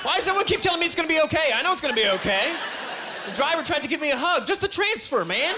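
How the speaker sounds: high, baffled protest; exasperated. Acted. Passenger refusing sympathy from strangers and driver, exasperated. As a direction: push the pitch up, fed up and bewildered.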